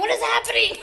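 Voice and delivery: high, startled mock baby voice; panicked baby voice. Baby just born asking what is happening, high mock panic. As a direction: squeak it like a baby in shock. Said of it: "They're probably like".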